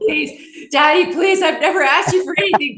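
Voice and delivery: high, pleading, building to a shout; childish begging. Retold or imitated. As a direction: beg daddy like a whiny kid.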